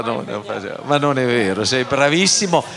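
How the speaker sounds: ordinary talk, nothing marked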